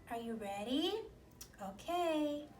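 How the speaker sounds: usual speaking voice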